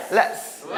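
"Let's" as one crisp syllable; no vowel after "Let's"; English ts ending. Shown as a demonstration. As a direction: hiss the ts of "Let's". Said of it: "let이라고 하지 말고".

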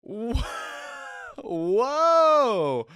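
loud, high-pitched whoa through laughter; shocked whoa. Real, not acted. Reactor floored by the character's insult, loud shocked whoa. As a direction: blurt a long, booming whoa in disbelief.

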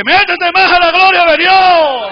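high, drawn-out acted shout; shouted encouragement. Preacher voicing cheers for a believer, shouted encouragement. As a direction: cheer them on at full voice. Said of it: "no le dice".

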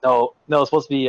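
ordinary talk, nothing marked

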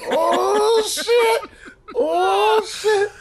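high, drawn-out cry through laughter; hysterical laughing shout. Real, not acted. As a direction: howl it out while cracking up. Hysterics at sheep and wolf joke.

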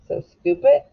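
ordinary talk

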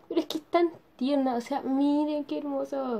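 a real delighted coo; gushing over the doll. Real, not acted.

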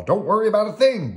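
high, loud, breezy confidence; Bert. A narrator's reading. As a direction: wave away all worry in a high voice. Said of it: "Bert answered".

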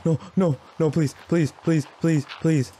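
high, loud panicked pleading; a frightened gamer pleading with the monster, high-pitched and frantic; terrified begging. Real, not acted. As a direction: beg for mercy in real terror.

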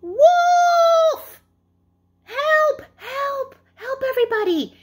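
high, loud, panicked cry across the valley; yelling for help. Acted. Shepherd boy yelling down to the villagers, loud fake alarm. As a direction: shout it out so the whole village hears. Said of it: "or I'm gonna yell out".

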